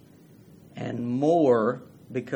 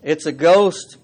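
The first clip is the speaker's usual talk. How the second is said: loud frightened cry; cried out in fear; 3 semitones higher, 10 dB louder, slower than usual. A narrator's reading. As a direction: shout it like you've seen a ghost. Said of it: "they cried out in fear".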